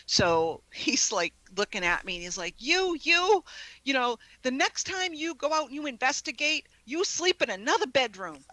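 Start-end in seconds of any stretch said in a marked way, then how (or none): 2.6-8.5 mock outrage, pitched up and emphatic; angry husband. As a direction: imitate a husband laying down the law. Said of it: "My husband was so angry with me".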